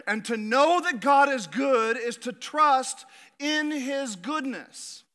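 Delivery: rising pulpit conviction; preaching. Acted. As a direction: drive each phrase home slowly. Preaches the main point.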